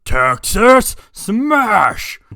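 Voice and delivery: long, drawn-out shouted attack name; attack call. Acted. All Might throwing his punch, slow bellowed attack cry. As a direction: roar the move name as the punch lands.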